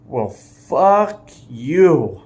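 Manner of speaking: drawn-out unbothered comeback; slow defiant fuck you; defiant retort. Retold or imitated. Girl retorts to driver.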